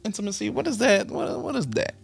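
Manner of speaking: high, fast, teasing faux-innocent voice; intimate voice. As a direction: play coy and curious. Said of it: "that's my intimate voice".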